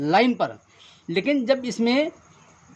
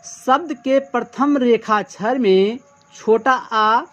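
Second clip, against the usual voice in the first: slow, even reading of a rule, word by word; slow dictation; slower than usual. Shown as a demonstration. Teacher slowly dictating the definition to students. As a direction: spell the rule out slowly for note-taking. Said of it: "तो क्या कहा है".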